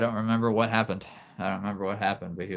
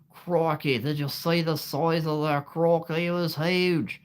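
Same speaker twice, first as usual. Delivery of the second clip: put-on Steve Irwin Australian accent; 8 semitones higher than usual. Streamer imitating the Crocodile Hunter for chat, in an Australian accent. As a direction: ham up the Aussie croc-hunter Australian accent. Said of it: "there's no way I could ever copy him".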